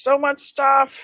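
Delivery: put-on sudden high, loud squeaky man-child voice; Pee Wee Herman voice. Showing her collection to viewers, a high, loud Pee Wee Herman voice. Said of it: "I didn't mean to do Pee Wee Herman's voice".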